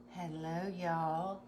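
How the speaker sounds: ordinary delivery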